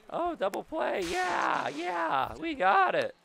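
high, loud shout of joy; excited cheer. Real, not acted. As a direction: cheer the double play. Cheering a double play.

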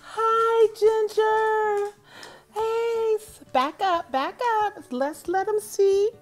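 high, loud call across the yard; calling the dog. Real, not acted. Owner calling her dog Ginger to come up, loud and high. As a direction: call the dog over from far off.

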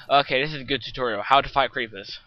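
loud joking tutorial voice; mock tutorial host. Mock tutorial, creeper appears.

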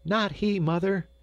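put-on high, lilting girl's voice; laughing young Gretel. A narrator's reading. Gretel answering her mother, high and merry. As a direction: pipe up high, merry and girlish. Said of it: "laughed Gretel".

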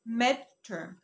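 midterm said slowly as medter; slow correct pronunciation. Shown as a demonstration. Teacher corrects midterm for viewers, slowly. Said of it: "اسمها midterm".

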